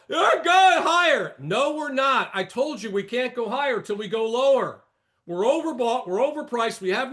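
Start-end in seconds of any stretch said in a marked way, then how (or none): 0.1-1.3 high, loud, drawn-out mock shout; hammed-up bullish yell, slow and high; mock cheerleading shout. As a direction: shout it like an overexcited market bull. Mimics the bulls on television.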